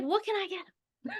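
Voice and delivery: high, hushed, lost; puzzled gift buyer. Retold or imitated. Customer stuck on a gift for mom, high and puzzled. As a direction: ask it like a stumped shopper stuck for ideas. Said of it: "you're just like".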